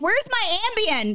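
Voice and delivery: high, exasperated "Where's my Ambien?"; mock exasperated self. Wife recalling missing pills, high exasperated mock question. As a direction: demand it in a high put-upon voice. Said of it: "I'm like".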